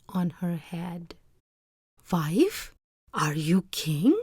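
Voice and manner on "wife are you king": hushed whisper, rising in wonder; whispered question to his crowned wife; awed whisper. Acted. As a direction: breathe the question in amazement. Fisherman awed before throne.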